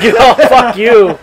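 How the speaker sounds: laughing retort. Real, not acted. Laughing back at teasing.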